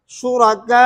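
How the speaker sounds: शुरका with the final आ held very long, closing on waw; tool with waw. Shown as a demonstration. Teacher showing ibdal with waw at full length. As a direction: stretch the last vowel of शुरका fully into a w. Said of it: "ये इसका क्या है".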